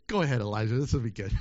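a deep chuckling aside from above; amused God. Retold or imitated. God watching Elijah, low amused voice. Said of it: "God's probably going".

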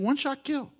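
high, quiet cocky boast; a hint of a cocky soldier; mock bragging. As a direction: toss it off like a sniper. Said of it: "he's saying".

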